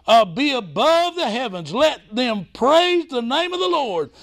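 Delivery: high, ringing sermon delivery; fervent preaching. Real, not acted. Preacher urging the congregation to praise, fervent.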